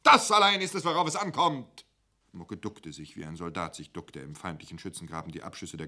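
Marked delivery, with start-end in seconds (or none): 0.0-1.8 very high, loud shout; laut brüllend, sehr hoch; emphatic yell. Acted. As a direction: bellow it to the whole canteen. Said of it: "brüllte emphatisch in die Kantine".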